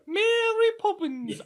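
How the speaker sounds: put-on very high, drawn-out stage English accent; bad English accent. Irish guest mimicking the film's English people, high mock English accent. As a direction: sing it out as cartoonish English, high and slow. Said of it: "that's how I want my English people to sound".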